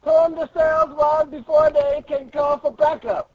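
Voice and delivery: high-pitched urgent orders; rally the crew before backup comes; urgent commander. Acted. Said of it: "And then he's like".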